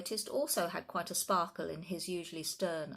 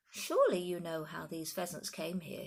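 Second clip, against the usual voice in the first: plain reading voice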